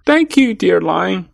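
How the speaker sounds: high-pitched, happy mouse voice; happy little mouse. A narrator's reading. Mouse freed by the lion, happily thanking him. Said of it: "was so happy".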